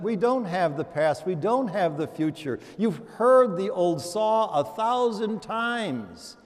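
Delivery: ordinary delivery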